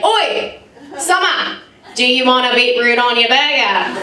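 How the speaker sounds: mock an Australian offering beetroot; Australian impression. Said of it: "You're like".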